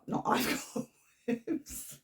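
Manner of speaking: normal talking voice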